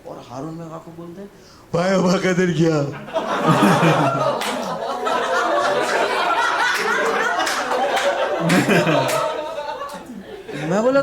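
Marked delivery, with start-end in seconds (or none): 1.7-2.8 loud, wailing question through laughter; blubbering whine for his friend; hammed-up sob; mock crying. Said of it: "वो रोते रोते बोल रहा है". 2.9-10.6 high, helpless cackle of laughter; real laughing fit; loud laughter. Real, not acted. Laughing fit at own story.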